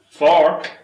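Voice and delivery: high, loud, rising call; loud drill call. Acted. Drilling Dave on who he is, loud rising call.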